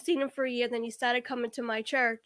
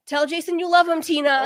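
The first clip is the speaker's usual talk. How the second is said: loud, raised-voice order; mock yell, louder and pressing; retold yell; 4 semitones higher, 7 dB louder than usual. Friend yells at her to say it, retold yell. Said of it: "he yells at me".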